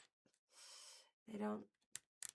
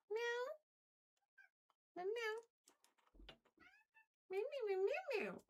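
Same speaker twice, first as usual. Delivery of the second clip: very high squeaky meow; high-pitched kitty call; cat meow; 13 semitones higher than usual. Pretend. Calling the cat again.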